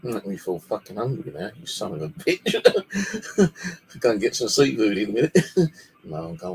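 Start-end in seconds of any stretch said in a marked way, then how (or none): 2.6-3.9 high burst of real laughter; laughing. Real, not acted. Laughs at own joke.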